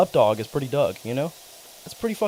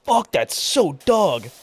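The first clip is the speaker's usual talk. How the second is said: slow, a little higher drawn catchphrase; mock drawled catchphrase; friend's catchphrase; 6 semitones higher, 5 dB louder, much slower than usual. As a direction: stretch it out, savoring the coolness. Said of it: "he'd go".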